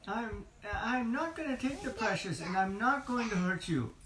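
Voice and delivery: high, fast, protesting creature voice; high whiny Gollum denial; pleading Gollum. Acted. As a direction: beg to be believed, hurriedly. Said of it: "Gollum said".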